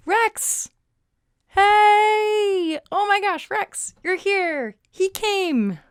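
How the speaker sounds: high delighted greeting; excited greeting. Real, not acted. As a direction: welcome a friend, thrilled. Friend Rex joins the stream.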